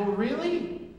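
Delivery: high, loud mock disbelief; sarcastic retort. Preacher retells scoffing at evangelist, high and loud sarcasm. Mocking sarcastic reply retold.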